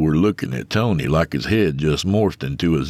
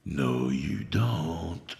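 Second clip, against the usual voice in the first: very soft slow whisper; 10 dB quieter, much slower than usual. Acted. Inner voice whispers to Tony, slow whisper. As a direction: breathe it like a voice in his head. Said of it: "a voice whispered".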